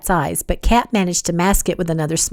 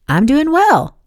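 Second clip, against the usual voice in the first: higher, polite upbeat reply; pleasant; 7 semitones higher, 4 dB louder, slower than usual. A narrator's reading. Wyatt politely answering Kat's greeting, pleasant. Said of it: "he said pleasantly".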